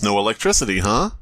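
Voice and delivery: usual speaking voice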